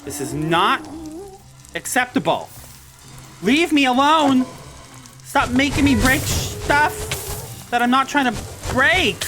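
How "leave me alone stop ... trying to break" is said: exasperated drawn-out complaint; frustrated yell. Real, not acted. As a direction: yell at the mobs to quit it. Mobs make her break blocks.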